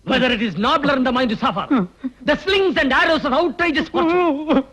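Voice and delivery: slow, high-pitched declaiming; theatrical recitation. Acted. Man reciting Hamlet's soliloquy, theatrical.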